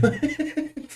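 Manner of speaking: high, giggly laugh; high-pitched laugh. Real, not acted. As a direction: burst into a high cackling giggle. Laughs at own remark.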